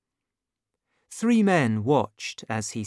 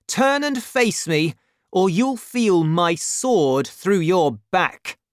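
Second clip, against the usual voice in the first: high, raised challenge slowing into menace; angry threat; 6 semitones higher, 5 dB louder than usual. Acted. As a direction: threaten him to make him turn. D'Artagnan threatens the stranger.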